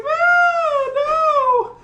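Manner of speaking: very high, loud crying shout; hammed-up scared cry of no; frightened wail. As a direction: wail like a terrified nerd. Hal cries out in fear.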